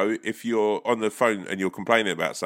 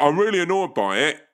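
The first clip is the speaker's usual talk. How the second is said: higher-pitched mock annoyance; annoyed customer; 8 semitones higher, 4 dB louder than usual. Caller complains to call centre, mock annoyed. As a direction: act out a mildly irate caller. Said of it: "And you're like".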